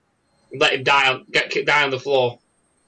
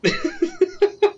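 high squealing laugh. Real, not acted. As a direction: giggle up high. Laughing at the story.

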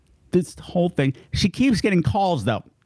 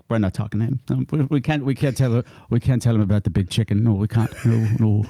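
low Irish brogue, cagey refusal; Irish relatives; 5 semitones lower than usual. Acted. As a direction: play secretive Irish relatives, pitched down. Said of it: "I couldn't confirm with any Irish relatives".

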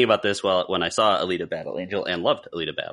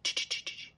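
whispered clap sounds; 12 dB quieter than usual. Retold or imitated. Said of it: "like that".

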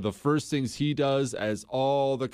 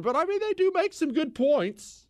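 mock voice. Mocking a view, odd voice.

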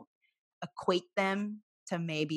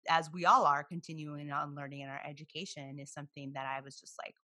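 ordinary talk, nothing marked

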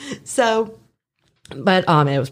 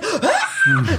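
very high squeal through a laugh; a hammed-up outraged squeal; mock shocked gasp. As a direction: let out a shrill laughing shriek. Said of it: "I'm like".

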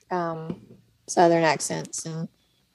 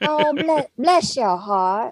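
slow, laid-on-thick Southern drawl; thick Southern accent; 4 semitones higher, much slower than usual. Shown as a demonstration. Southern guest showing co-hosts her thicker accent, drawled Southern. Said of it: "really lay it on thick".

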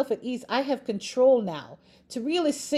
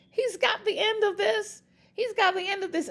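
exultant assurance; 10 semitones higher than usual. Real, not acted. Exulting that God has it handled.

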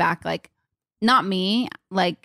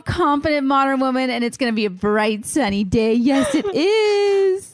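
sunny voice; 4 semitones higher than usual. Shown as a demonstration. Host gives a birthday girl a morning pep talk, high sunny voice. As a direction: coo encouragement, stretching the last words way up. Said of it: "It's my sunny voice".

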